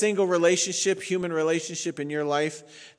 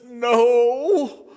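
louder, weepy clipped answer; mock tearful no; 6 semitones higher, 8 dB louder than usual. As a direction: answer through fake tears. Weeper's sniffling answers.

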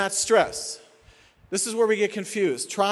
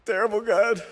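weepy, broken-up lament to God; mock crying; 3 semitones higher than usual. Preacher acting someone crying over a trial, tearful. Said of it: "We can be crying over a trial".

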